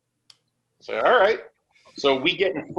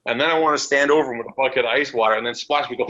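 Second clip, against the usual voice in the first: ordinary talk, nothing marked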